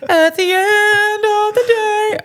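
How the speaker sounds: high drawn-out cliché; mock sing-song. Real, not acted. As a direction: stretch it like a song.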